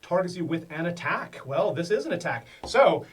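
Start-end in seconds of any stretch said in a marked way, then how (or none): none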